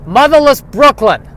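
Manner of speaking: loud, high Brooklyn-accented Motherless Brooklyn. Shown as a demonstration. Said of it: "the accent is in the name".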